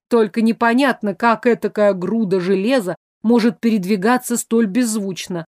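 usual speaking voice

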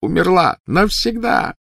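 high, loud cry of grief; anguished outburst. A narrator's reading. Snape grieving Lily to Dumbledore, in a loud anguished cry. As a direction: voice soaring in despair.

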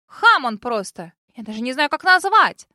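loud, furious outburst; angry exclaim. A narrator's reading. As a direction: spit it out, offended. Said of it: "восклицает вторая".

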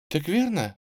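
high, timid double-check; surprised. A narrator's reading. Thin girl checking with the strangers, mildly surprised. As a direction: ask back in mild disbelief. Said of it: "переспросила она, удивлённо оглядывая нас".

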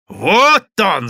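loud, high accusing cry; wailing yell. A narrator's reading. Madame pointing out the culprit to the crowd, wailing yell. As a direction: yell it out for everyone to hear. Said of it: "завопила она".

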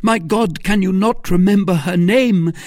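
high, loud, desperate plea; frantic cry. A narrator's reading. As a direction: demand it at the top of the voice. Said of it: "cried D'Artagnan".

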